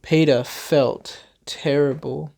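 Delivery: ordinary delivery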